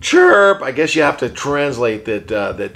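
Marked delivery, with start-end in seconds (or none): 0.0-0.6 parakeet chatter. Pretend. Puppet bird answers question.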